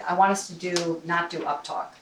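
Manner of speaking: usual speaking voice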